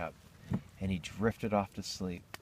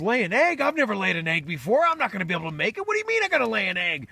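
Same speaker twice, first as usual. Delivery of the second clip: loud, high, racing protest; comic panic; 12 semitones higher, 8 dB louder, faster than usual. Acted. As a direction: freak out fast at top pitch, hammed-up. Hen-man panics about laying egg.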